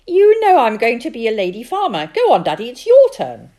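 put-on high, loud, insistent child voice; a pushy little girl pressing her dad to play; bossy little girl. Sophie nags her father to take his turn, high and loud.

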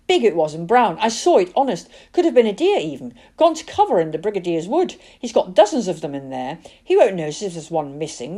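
loud, excited country man; eager poacher. Acted. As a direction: talk fast and greedy, thrilled. Poacher eager about the deer.

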